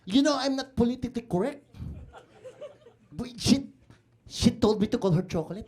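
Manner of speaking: put-on indignant Filipino uncle with a thick accent; Tito Chito. Uncle defending himself to his relative, indignant Filipino-accented character.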